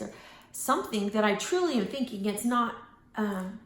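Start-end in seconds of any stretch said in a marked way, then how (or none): none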